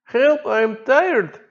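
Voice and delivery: high, slow, drawn-out whimper; whiny tired plea. Retold or imitated. A tired diver whining to the boat crew, high and slow. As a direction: stretch it out like an exhausted man pleading. Imitating Darius in the water.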